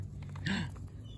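soft breathy surprised gasp; hush-gasp in awe; whispered gasp. Real, not acted. Surprise at chipmunk.

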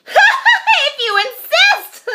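high, loud, slow laughing reply; eerie laughing. Acted. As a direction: laugh the words out wildly like a hyena. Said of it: "laughing eerily".

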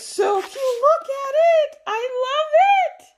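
drawn-out, very high squeal; squeaking with delight, vowels stretched long; squeaky voice. Real, not acted. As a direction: gush in a slow, squeaky squeal. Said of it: "my voice is really squeaky today".

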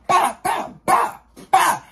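high, sharp imitated smacks; mock smack noises. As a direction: slap sounds from the mouth, pitched high. Imitating the sound of the blows.

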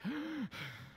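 heavy out-of-breath gasp, imitated; mock exhausted gasp. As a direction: gasp like you're about to collapse. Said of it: "her father is like".

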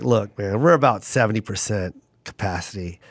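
low, subdued retold apology; lightly voiced stage manager, letting him down gently; sympathetic stage manager. Stage manager breaking bad news to the comic, low and sympathetic. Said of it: "he felt so bad for me".

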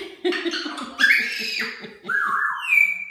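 high squeaky laugh. Real, not acted. Laughing at the dancing parrot.

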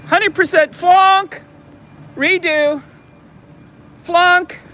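high, loud pretend verdict; punchy mock-strict pronouncements; mock harsh judge. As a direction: hand down a hammed-up failing grade. Mock grading of the landscaping.

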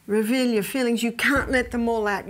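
normal talking voice

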